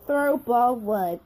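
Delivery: ordinary talk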